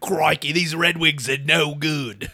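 slow, hammed-up Australian accent; jokey broad Australian; mock Australian accent. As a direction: draw it out in a cartoon Aussie accent. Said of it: "that's a funny accent".

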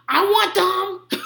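high, slow mock demand; imitating aggressive toddler. Three-year-old eyeing mommy's glass of water, aggressive toddler imitation. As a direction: drag it out as a pushy kid. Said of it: "and he'll be like".

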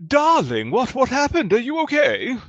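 high, loud, fussy noble lady; halfling lady voice. Acted. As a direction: coo over him like a pampered noblewoman. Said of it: "looks over and goes".

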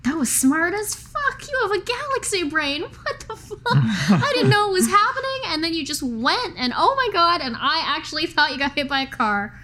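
high, excited, laughing praise; can't stop gushing over her. Acted. Elaine gushes over clever Mouse after the stunt, excited. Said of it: "gushing over Mouse".

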